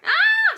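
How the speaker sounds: very high, loud squeal; piercing child's scream; high screech. A narrator's reading. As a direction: screech like an outraged little boy. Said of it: "screeched Peter".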